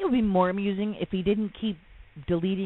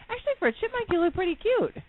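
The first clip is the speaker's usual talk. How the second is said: teasing high voice; 12 semitones higher than usual. Real, not acted. Teasing husband, chipmunk cheeks.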